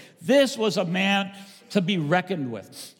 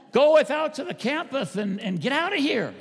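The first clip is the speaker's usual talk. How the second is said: laughing mock Pharisee; 7 semitones higher than usual. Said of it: "that's how they talked".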